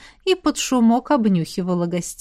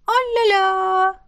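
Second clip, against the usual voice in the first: очень высоко и протяжно; восхищённое пропетое «о-ля-ля»; оживлённо; 10 semitones higher, much slower than usual. Acted. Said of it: "оживилась Искра". (дух Искра оживлённо восклицает)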